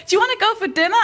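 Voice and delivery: high, chirpy question; bubbly invitation. Acted. Happy best friend suggesting dinner, bubbly. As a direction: ask with eager, mock-sweet enthusiasm.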